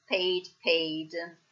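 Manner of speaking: "paid" with the ay glide, like "payd"; diphthong kept. Shown as a demonstration. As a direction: say each "paid" as PAYD, never "ped". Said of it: "pay al passato diventa paid". ("paid" pronunciato con il dittongo, "peid")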